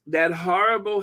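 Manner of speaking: usual speaking voice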